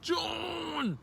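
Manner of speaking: showy sustained shout; drawn-out announcement. Real, not acted. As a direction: stretch the word out in a big high holler.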